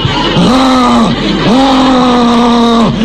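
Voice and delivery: Luca Brasi grunts. Retold or imitated. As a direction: grunt like a mob strangler in The Godfather. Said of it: "making noises like Luca Brasi in The Godfather".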